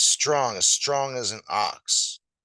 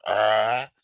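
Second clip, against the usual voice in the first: lion gulp; 8 dB louder than usual. Acted. Lion eating an ox.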